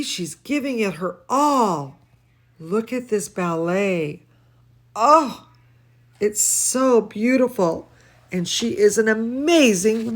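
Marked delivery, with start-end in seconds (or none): none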